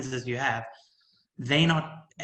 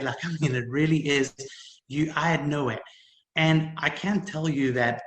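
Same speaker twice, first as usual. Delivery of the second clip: ordinary talk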